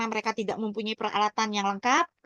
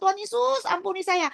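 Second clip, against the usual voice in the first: retold crying out; 7 semitones higher than usual. Survivor re-enacts begging Jesus for mercy, retold crying out. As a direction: re-enact a pitched-up cry, easing down by the last. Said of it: "Saya teriak sama Tuhan Yesus".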